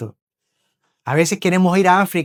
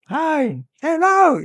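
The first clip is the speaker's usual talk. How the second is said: put-on children yelling; 11 semitones higher, much slower than usual. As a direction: mimic kids' high, piercing calls. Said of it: "empiezan a gritar".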